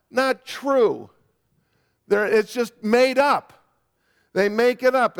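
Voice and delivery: fervent preacher, pitch lifted; emphatic preaching. Real, not acted. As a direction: press the conviction on the congregation.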